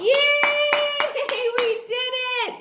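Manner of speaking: high, delighted cheer with a laugh. Real, not acted. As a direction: celebrate with the class. Teacher celebrating with class.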